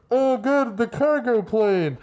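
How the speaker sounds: high, stagey mock relief; dubbed-in bystander line. Imitating an actor dubbing in a line, mock relief. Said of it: "someone saying in A D R".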